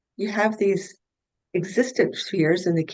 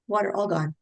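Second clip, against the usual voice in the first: ordinary talk, nothing marked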